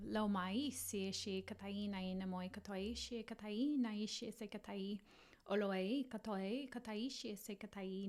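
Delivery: fluid ritual chant-like speech in invented syllables; light language. Acted. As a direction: let strange syllables flow in a continuous stream. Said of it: "doing any kind of light language work".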